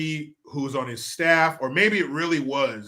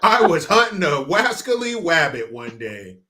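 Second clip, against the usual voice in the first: mock child letter; 5 semitones higher, 8 dB louder than usual.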